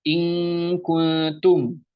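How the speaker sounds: "in" held as long "ing" before "kuntum"; ng reading. Shown as a demonstration. Teacher giving NG example, "in" as held "ing". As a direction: chant "in kuntum" very slowly with NG. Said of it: "gunahnya itu pakai N G".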